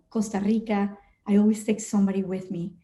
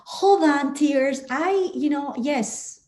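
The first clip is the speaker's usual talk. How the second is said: mock command; 5 semitones higher, much slower than usual. Pilot telling own tears to wait before landing, mock command. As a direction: halt your own tears, playfully firm.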